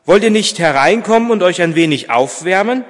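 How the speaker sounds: loud, friendly invitation; calling out. A narrator's reading. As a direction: invite them in from afar. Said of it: "rief Vater Martin".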